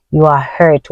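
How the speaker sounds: ordinary delivery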